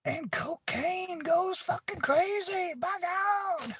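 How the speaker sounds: put-on voice far up in falsetto; absurdly high-pitched mock exclamation; high screech. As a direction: stretch it out in a very high, slow squeal.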